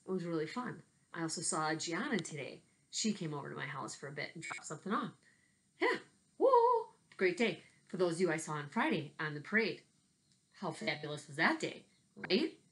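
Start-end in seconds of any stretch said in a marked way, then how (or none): 5.8-6.9 excited cheer. Real, not acted. Cheering at parade memory.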